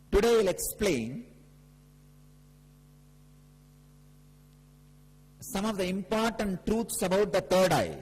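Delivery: emphatic guru's discourse; emphatic preaching. Real, not acted. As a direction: proclaim the teaching with force, pausing between phrases. Preaches the main teaching.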